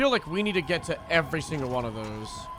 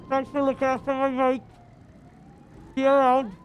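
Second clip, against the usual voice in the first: higher, friendly roleplay voice; high character voice; 8 semitones higher, 6 dB louder than usual. Acted. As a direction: put on a higher, lighter character voice.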